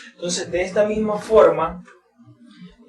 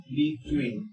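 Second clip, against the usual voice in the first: between split as be-TWEEN, falling end; second-syllable stress; 2 semitones lower, 6 dB quieter than usual. Shown as a demonstration. Teacher models prepositions, stress on TWEEN in between. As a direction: put the weight on TWEEN in between. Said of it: "la mayor fuerza de voz en esta segunda línea".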